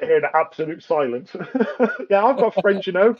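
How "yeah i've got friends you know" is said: higher, louder teasing take-off of a lonely boast; mocking his co-host. Teasing the co-host by voicing his boast, higher and louder. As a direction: mimic him as a proud loner, hammed up. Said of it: "He says".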